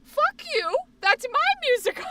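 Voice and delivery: high, loud mock yell; possessive mock yell. Fan yelling at West End concert, high loud mock outrage. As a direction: shriek it like a jealous fan guarding a secret. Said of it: "And I was like".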